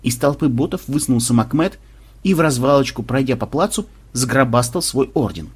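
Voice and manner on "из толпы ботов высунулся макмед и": usual speaking voice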